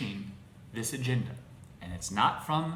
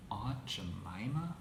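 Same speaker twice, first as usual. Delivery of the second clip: whispered disbelief; 3 semitones lower, 8 dB quieter, slower than usual. Real, not acted. Incredulous at brand called racist.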